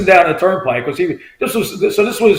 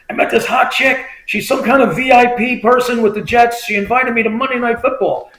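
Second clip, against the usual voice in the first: higher-pitched, eager buddy voice; retold excited bragging; 7 semitones higher than usual. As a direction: imitate a friend sharing big news. Friend brags about invitation.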